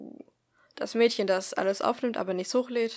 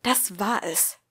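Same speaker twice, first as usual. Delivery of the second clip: louder, emphatic angry repeat; angry hiss; 6 dB louder, slower than usual. A narrator's reading. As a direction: drive each word home angrily. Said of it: "zischte sie zornig".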